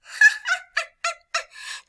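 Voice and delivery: high, staccato cackle; tiny birds' jeering laugh; shrill pretend ha-ha-ha; mocking bird laughter. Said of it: "little birds that are in the meadow start laughing".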